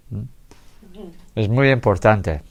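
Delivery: ordinary talk, nothing marked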